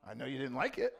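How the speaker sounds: ordinary delivery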